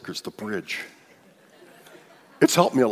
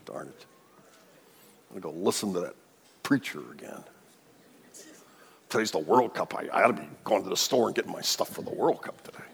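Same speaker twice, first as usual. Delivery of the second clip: softer, lower mock grumble of a reluctant churchgoer; grudging grumble; 4 semitones lower, 5 dB quieter than usual. Reluctant churchgoer griping about Sunday, soft mock grumble. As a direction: mutter it like you'd rather be anywhere else. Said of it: "you might have got up today saying".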